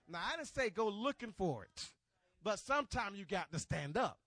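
loud, high, punched-out exhortation; rally the church to stand; full-force preaching. Real, not acted. Preacher urging the congregation to stand up, full-force preaching.

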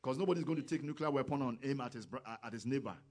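loud, fast, rising fervor; fiery sermon climax; preaching at full force. Real, not acted. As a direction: drive it home like a revival preacher.

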